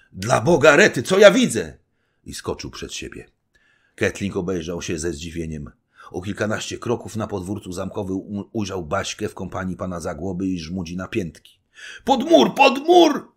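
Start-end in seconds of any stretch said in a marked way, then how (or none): 0.1-1.8 high, booming cry of alarm; sudden great shout. A narrator's reading. Little knight suddenly cries out toward the castle yard, great shout. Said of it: "krzyknął nagle wielkim głosem". 12.0-13.3 very high, loud shouted order; urgent shout. A narrator's reading. Little knight dragging them to shelter, urgent shout. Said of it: "krzyczał mały rycerz".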